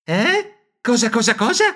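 high stammered panic; high squeaky stammer. Acted. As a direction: stammer it, caught off guard.

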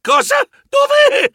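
very high, loud bursts of alarm; jolted, high-pitched yelping; startled squeal. A narrator's reading. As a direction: squeal each question separately in panic. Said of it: "Io strillai".

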